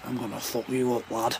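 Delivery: low, hushed fighter's menace; quiet threat. Acted. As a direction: threaten him under your breath, low. Fighter's threat just before striking.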